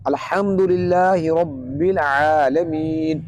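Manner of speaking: very slow tajweed recitation; Quran recitation. Shown as a demonstration. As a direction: recite with long, drawn-out vowels. Reciting Quran verse as example. (تجوید کے ساتھ ٹھہری ہوئی تلاوت)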